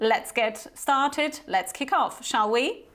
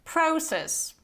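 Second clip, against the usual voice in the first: British "process" with long O, "PROH-sess", stretched out slowly; slow, careful British process with an "oh" vowel; British pronunciation; 4 semitones higher than usual. Shown as a demonstration. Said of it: "it's process".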